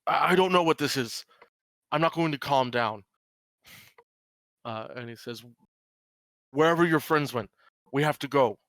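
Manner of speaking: insist, upset and desperate; father refusing to calm down, upset. Acted. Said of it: "he goes".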